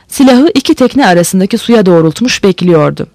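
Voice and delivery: ordinary delivery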